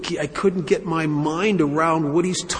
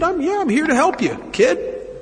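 pitched well up, quick and eager; Woody's helpful voice; 11 semitones higher than usual. Retold or imitated. Woody reassuring the narrator he's there to help, eager and high. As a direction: play the cheerful do-gooder offering help.

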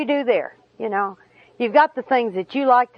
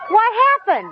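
laughing shout; 8 semitones higher than usual. Retold or imitated. Finding him under the building.

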